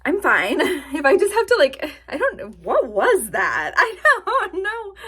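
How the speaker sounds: high, loud giggling through words; can't stop laughing; laughing fit. Real, not acted. Cracking up at her own stomach noise, giggling.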